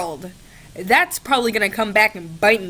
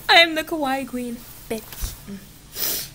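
higher, bolder take on Kawaii Queen; higher sassy declaration; 4 semitones higher, slower than usual. Shown as a demonstration. Declaring herself the Kawaii Queen again, higher and sassier. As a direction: fling the title out proudly. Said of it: "Yes, I do".

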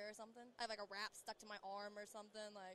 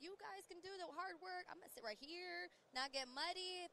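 lazy diva; 8 semitones higher, slower than usual. Retold or imitated. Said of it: "I was like".

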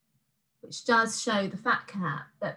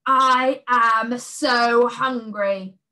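loud, very slow monster growl; a starving beast growling; hungry growl; 3 semitones higher, 6 dB louder, much slower than usual. A narrator's reading. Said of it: "growled the beast".

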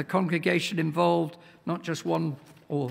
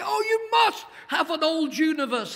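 higher, mock-naive retort; mocking the sceptics; 8 semitones higher than usual. Preacher mimicking old-universe critics, mildly mocking. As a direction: parrot the doubters with a sneer. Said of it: "they say".

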